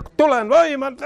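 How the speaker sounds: ordinary talk, nothing marked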